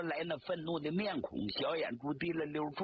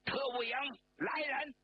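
高声朝门外呼唤; 大声喊; 11 semitones higher than usual. A narrator's reading. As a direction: call out high to the guards. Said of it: "大声冲着室外喊道". (高声喊人)

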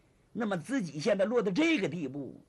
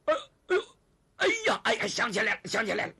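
high, loud, repeated exclamations settling down; acted astonished recognition; sudden recollection; 3 semitones higher, 5 dB louder, faster than usual. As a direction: cry out as memory strikes, then confirm. Tang suddenly remembers who she is.

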